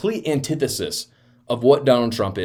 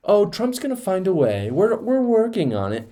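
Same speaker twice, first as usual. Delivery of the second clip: high, mocking impression of a cagey schemer; mock mysterious; 9 semitones higher than usual. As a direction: mimic coy secrecy in a raised pitch. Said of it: "wants to be all mysterious and say".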